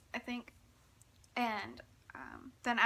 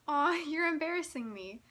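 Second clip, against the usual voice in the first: playful mock embarrassment; 5 semitones higher, 5 dB louder than usual. [name] teasing the fan.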